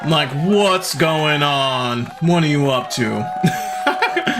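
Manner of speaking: long, drawn-out suspicious questioning; slow, playful parental suspicion; mock suspicion. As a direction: stretch every word like a wary parent. Suspicious parent reacting to flattery.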